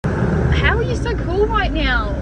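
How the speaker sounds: high-pitched incredulous rant; rattled, half laughing at the scare; rattled disbelief. Real, not acted.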